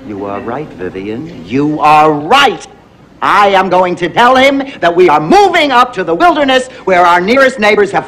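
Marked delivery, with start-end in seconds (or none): 0.1-1.4 much softer and lower, thoughtful; quiet realization. Acted. Realising, speaking softly. 1.4-5.1 firm resolve. Acted. Decides firmly after quiet take.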